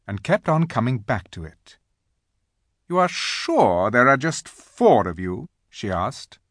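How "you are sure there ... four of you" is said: loom over him as the haughty Queen; imperious Queen. A narrator's reading. Queen probes Edmund.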